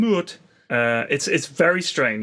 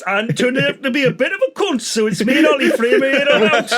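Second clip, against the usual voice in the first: high-pitched presenter impression; Dec; 7 semitones higher, 4 dB louder than usual. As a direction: put on a raised comic voice, addressing the lads. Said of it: "Dec is just, like, you know, looking".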